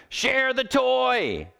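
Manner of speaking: high, slow exasperated parent yell; drawn-out parental shout; mock yell. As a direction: call it across the room, stretched out. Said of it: "when you yell across the room".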